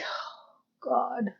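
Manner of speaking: barely voiced, breath-only groan of dismay; hushed mock despair; exasperated reaction. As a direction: sigh the words out very softly. Own reaction to the line.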